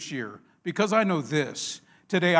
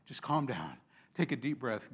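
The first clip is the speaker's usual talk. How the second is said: hushed and low, softer and lower, breathing it out; a hushed, soothing self-talk; soft calming demo; 4 semitones lower, 7 dB quieter than usual. Shown as a demonstration. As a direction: say it quietly to calm yourself down. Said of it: "that's what he was talking".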